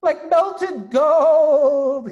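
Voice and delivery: high, loud, very slow and half sung; rapturous gasp. Acted. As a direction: overcome by the taste. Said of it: "he gasped".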